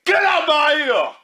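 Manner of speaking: high, stretched-out irritated shout; irritated yell. Real, not acted. To the man making noise at his ear, irritated yell. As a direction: yell to drive someone away. Snaps at noisy man beside him.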